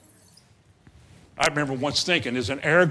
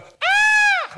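sudden high yelp of fear; frightened cry; 7 dB louder than usual. Retold or imitated. Preacher imitating the disciples' terrified cry. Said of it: "like that kind of a cry".

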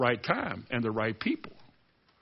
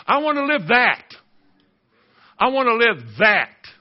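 high, loud, declaring preacher voice; full-force preaching; 7 semitones higher, 5 dB louder than usual. Real, not acted. Preacher declaring Jesus opened the prison doors, full-force preaching. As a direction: cry it out to the congregation in punched phrases.

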